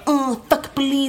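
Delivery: high, drawn-out mock whine; exaggerated mimicry of indignant complainers. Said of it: "Och folk bara".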